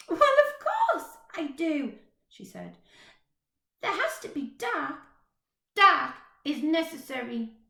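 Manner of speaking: put-on high, chatty little girl, a bit bossy. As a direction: play a cheeky small girl. Little girl's voice in story.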